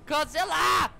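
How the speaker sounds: high, loud shout of GODZILLA, falling at the end; full shout, no question in it; shouted call. Pretend. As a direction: yell the monster's name outright. Calling out to a monster.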